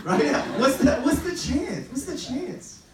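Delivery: high excited exclaiming; laughing in disbelief. Real, not acted.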